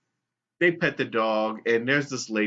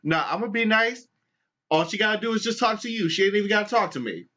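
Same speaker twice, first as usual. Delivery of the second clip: high, fast indignant comeback; mock-offended lady; sassy retort; 10 semitones higher, faster than usual. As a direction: brush it off with a quick high sass. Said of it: "lady with the cake is just like".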